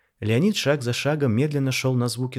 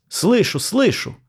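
loud, much higher cry; guard shouting back at invisible voices; shout into the void; 11 semitones higher, 7 dB louder, slower than usual. A narrator's reading. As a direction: yell it into the empty hall. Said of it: "крикнул в пустоту Леонид".

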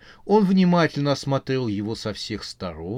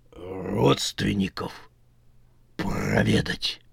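slow, low, fading undead voice; slow zombie voice; 5 semitones lower, slower than usual. A narrator's reading. Zombie explains to the witch he wants to visit relatives, slow and low. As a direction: drawl it down to a breath.

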